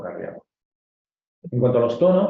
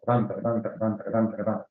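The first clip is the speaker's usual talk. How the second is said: vocalised heartbeat gallop, evenly repeated; imitated gallop rhythm; 3 dB quieter, slower than usual. Shown as a demonstration. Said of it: "Lo oímos de esta manera".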